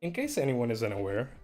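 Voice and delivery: ordinary talk, nothing marked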